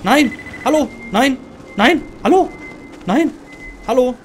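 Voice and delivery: short, high, loud yelps; panicked calling. Real, not acted. Player calling into the scary dark, panicked calling. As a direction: yelp each word separately in alarm.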